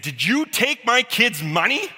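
a father confronting the bully by the shirt; furious dad. Retold or imitated. Angry dad grabs bully's shirt.

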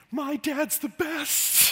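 put-on a child in awe of his dad; adoring little kid. Kid's admiring thought.

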